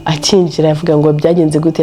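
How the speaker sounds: ordinary talk, nothing marked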